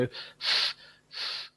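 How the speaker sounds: soft "f, f" with breath only through the teeth; teacher demo of "f"; unvoiced f sound. Shown as a demonstration. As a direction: model "f" as a quiet hiss on the lip. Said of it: "I can go".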